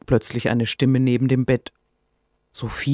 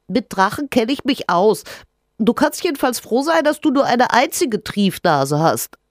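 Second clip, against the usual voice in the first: cheeky little creature; 10 semitones higher, 4 dB louder than usual. Acted. Rinus voice.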